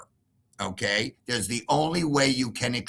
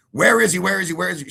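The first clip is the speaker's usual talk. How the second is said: urgent demand; 7 semitones higher, 6 dB louder, faster than usual. Retold or imitated. Police demanding repeatedly.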